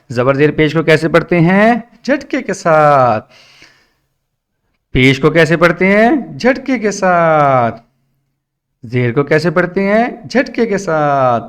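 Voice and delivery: rising, stretched question-and-answer recitation; sing-song drill. Acted. Teacher quizzing a child on short vowels, sing-song drill. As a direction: drill it like a class chanting answers back.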